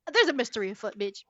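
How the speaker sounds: normal talking voice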